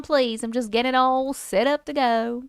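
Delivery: normal talking voice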